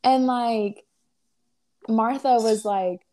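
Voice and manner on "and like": normal talking voice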